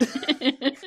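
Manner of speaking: playful Cockney put on for a joke; jokey, high-pitched Cockney; mock Cockney accent. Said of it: "gotta be Cockney".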